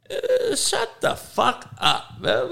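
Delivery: high, slow, exasperated shut the fuck up; disgusted outburst. Real, not acted. To viewers making excuses, a high, slow, disgusted outburst. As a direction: drag it out high in disbelief.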